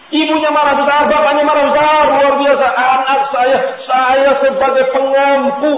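loud, high retelling of the furious father; angry parents. Retold or imitated. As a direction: hammer home the parents' rage. Angry parents' outburst retold.

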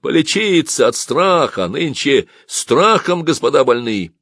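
loud, derisive quip; mocking. A narrator's reading. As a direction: taunt the fleeing gentry. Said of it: "послышалось насмешливое".

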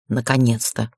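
low, mildly grumbled relief; grumbling grandfather. A narrator's reading. Grandfather greeting the returning couple, a low mild grumble. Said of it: "проворчал дедушка Энхем".

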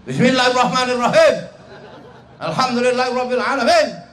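fearsome recitation. Retold or imitated. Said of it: "baca Quran orang sekampung takut".

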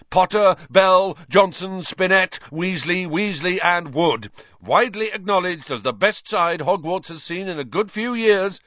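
high, loud stadium announcer voice; excited commentator. A narrator's reading. Lee Jordan introduces the Gryffindor team, excited announcer. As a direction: announce each name to the stands.

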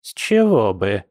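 doubtful, suspicious granny; distrustful. A narrator's reading. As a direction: question it with open disbelief, falling sharply at the end. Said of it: "недоверчиво засомневалась бабуля".

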